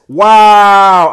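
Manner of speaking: loud, drawn-out exclamation; big, stretched-out cheer; amazed shout. Real, not acted. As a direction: belt it out in astonishment. Amazed at the award screen.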